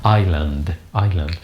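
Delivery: teacher repeating "island" for students; model pronunciation, repeated. Shown as a demonstration. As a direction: say "island" as eye-land, no S. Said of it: "something like this".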